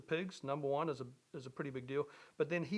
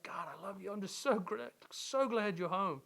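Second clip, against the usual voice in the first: tender relief; 4 semitones higher than usual. Retold or imitated. Father embraces returning son.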